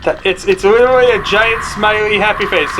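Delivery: ordinary talk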